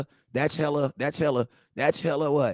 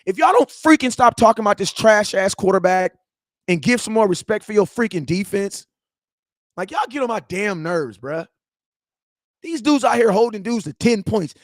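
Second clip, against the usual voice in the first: higher and louder, fed up and heated; pissed off rant; 7 semitones higher, 7 dB louder than usual. Real, not acted. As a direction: vent at the fans loudly, exasperated. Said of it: "I'm pissed off now".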